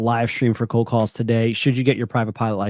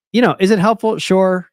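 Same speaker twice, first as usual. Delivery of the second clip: emphatic upbeat; 10 semitones higher, 8 dB louder than usual. Real, not acted. Streamer answers viewer about pilot license.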